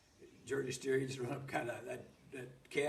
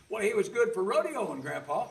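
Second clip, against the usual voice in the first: upset protest; 10 semitones higher, 11 dB louder than usual. Retold or imitated. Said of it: "Oh, I was so upset".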